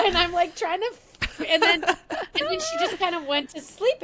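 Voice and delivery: high, laughing through the words; can't stop laughing. Real, not acted. Daughter laughing through the memory of her mother.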